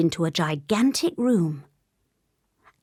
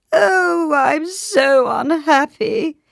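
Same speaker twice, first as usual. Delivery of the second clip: high, loud, drawn-out wail of misery; theatrical high-pitched lament; sobbing king; 9 semitones higher, 6 dB louder, much slower than usual. A narrator's reading. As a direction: wail it slowly like a weeping king. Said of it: "he keeps sobbing over and over".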